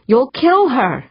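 high-pitched, alarmed protest; desperate pleading. A narrator's reading. Prisoner warning torturers she will die, desperate plea.

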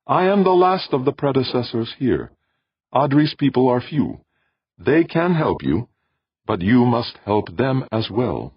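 high, measured voice of an alien being. Acted. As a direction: speak as a calm cosmic presence. Alien voice explains.

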